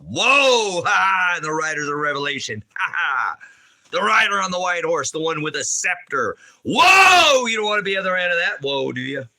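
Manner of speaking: shouting preacher warning of judgment; fire-and-brimstone preaching. Acted. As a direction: thunder the woe at the crowd. Preaching about riders of Revelation.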